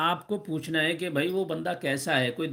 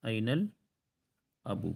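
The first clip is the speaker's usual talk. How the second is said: أين الأب slowed right down and softer; slow model repeat; 3 semitones lower, 4 dB quieter, much slower than usual. Shown as a demonstration. Teacher repeating for students, slow and careful. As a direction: repeat it slowly for the class. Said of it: "father والا word کیا ہے".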